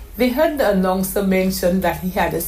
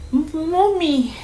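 put-on high, slow, breathless child; a small girl amazed, drawing it out; awed little girl; 9 semitones higher, slower than usual. A narrator's reading. Donome to Mommy after the broadcast, awed child voice. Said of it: "Donome said at last".

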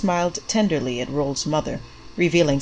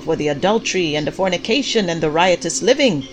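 high, loud fire-and-brimstone preaching; preacher's shout; 6 semitones higher, 4 dB louder than usual. A narrator's reading. Pastor Clyde shouts his sermon at the congregation, high and loud. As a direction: thunder it out like a revival preacher. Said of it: "Pastor Clyde shouted".

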